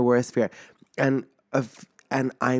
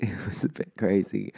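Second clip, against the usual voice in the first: whispered aside; 3 semitones lower, 6 dB quieter than usual. Real, not acted. Aside after weekly update.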